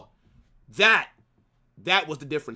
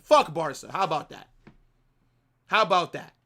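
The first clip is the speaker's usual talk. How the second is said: ordinary delivery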